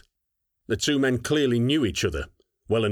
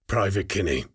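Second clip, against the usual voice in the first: soft growl. Acted. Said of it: "speaking softly ... growl with malice".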